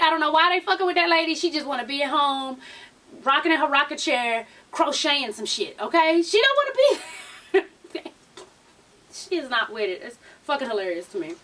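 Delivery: normal talking voice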